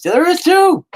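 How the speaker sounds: a loud high-pitched contradiction; sudden high retort. Acted. Retort to own claim.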